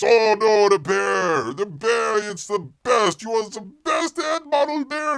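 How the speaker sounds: high, loud, gushing agent voice; pleading agent. Acted. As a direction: gush over the bear like a desperate agent. Agent begging bear, high excited voice.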